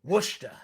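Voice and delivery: Worcester said as "WUSH-ta"; Worcester in two syllables, New England style; local New England pronunciation. Shown as a demonstration. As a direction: drop the R in Worcester, say "Wushta". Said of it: "so I can say Worcester".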